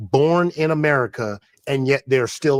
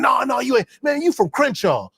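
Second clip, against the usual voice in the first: mocking doubter; 9 semitones higher than usual. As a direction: throw it back like a dismissive heckler. Mocking people who doubt him.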